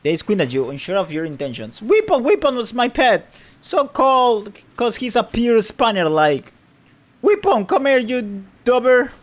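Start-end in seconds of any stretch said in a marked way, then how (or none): 1.8-9.1 loud, higher frantic calls for a lost pet; shouting. Acted. Man calling out loudly for his lost pet. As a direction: bellow the pet's name across the street.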